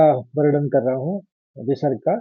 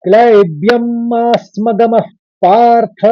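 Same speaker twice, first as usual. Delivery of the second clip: measured Sanskrit chant, a little raised; shloka recitation; 5 semitones higher, 4 dB louder than usual. Shown as a demonstration. Teacher showing students the first line, chanted. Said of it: "पहला चरण इस तरह से पढ़ा जाएगा".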